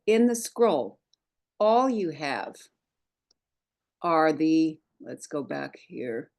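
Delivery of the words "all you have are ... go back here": normal talking voice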